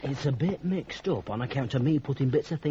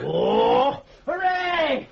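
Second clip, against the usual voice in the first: much higher, louder whoop of joy; delighted cheer; 10 semitones higher, 7 dB louder, much slower than usual. Acted. Zack overjoyed at the chance to try the clothes on, cheering. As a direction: burst out like a thrilled child. Zack's joy at being allowed.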